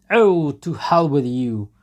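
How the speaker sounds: loud, high outburst; furious shout. A narrator's reading. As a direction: explode in rage. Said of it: "shouted Mikolka furiously".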